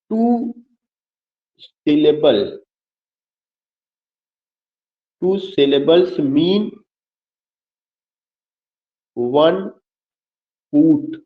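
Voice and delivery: word by word, long pauses between; slow dictation. Real, not acted. Teacher giving students a definition to write, slow dictation. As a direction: dictate slowly for note-taking.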